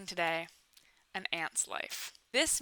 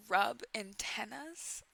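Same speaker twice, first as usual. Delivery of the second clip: usual speaking voice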